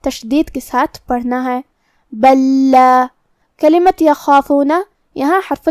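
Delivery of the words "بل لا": "بل لا" with the two lams fused into one doubled lam; "بل لا" blended with a single geminated lam; merged lam. Shown as a demonstration. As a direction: say "بل لا" as "bal-la", one held double L. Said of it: "ہمیں یہاں پہلے لام کو دوسرے لام میں ملا کے ایک".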